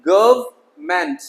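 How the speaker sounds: गवर्नमेंट slowly as "guv-ment"; slow two-part गवर्नमेंट, n left out; silent n. Shown as a demonstration. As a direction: stretch गवर्नमेंट without the middle n. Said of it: "ये silent हो जाएगा".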